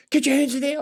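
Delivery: high, loud command; shouted police order. Retold or imitated. Officer yelling at him to put his hands there, loud high shout. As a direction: bark the order like a nervous cop.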